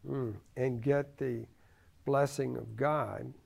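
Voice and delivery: ordinary delivery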